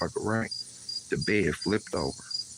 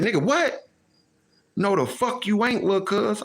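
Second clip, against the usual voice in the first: incredulous; 11 semitones higher than usual. Retold or imitated. Cousin's shocked objection.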